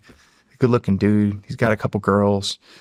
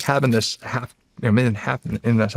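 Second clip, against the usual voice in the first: normal talking voice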